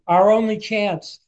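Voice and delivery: mild high-pitched mock yell; retold shout. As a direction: imitate calling back across the water. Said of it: "Paul shouts one more time".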